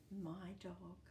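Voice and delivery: ordinary delivery, nothing marked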